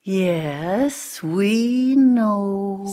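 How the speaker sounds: long, drawn-out knowing sigh; sighing, heard-it-all tone; weary sigh. A narrator's reading. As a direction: stretch each word out with a sigh. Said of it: "sighed Ebony".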